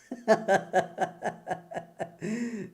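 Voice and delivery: quiet mocking laugh; soft scornful chuckle. Real, not acted. Preacher laughing at the idea of God having a God. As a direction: laugh under your breath at the idea.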